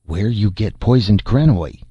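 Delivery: plain reading voice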